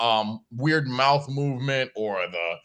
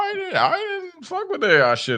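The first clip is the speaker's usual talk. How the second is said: high voice. Acted. High mock voice.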